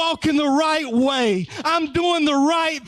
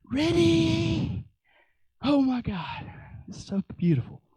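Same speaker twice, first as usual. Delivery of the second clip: hushed awe; 2 semitones higher, 6 dB quieter than usual. Real, not acted. Awed before playing song.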